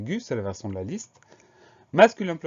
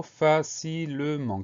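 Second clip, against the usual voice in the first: "facilement" slowly split as fa-ci-le-ment; syllable by syllable; 4 semitones higher, much slower than usual. Shown as a demonstration. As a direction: dictate "facilement" one syllable at a time. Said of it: "Quatre syllabes".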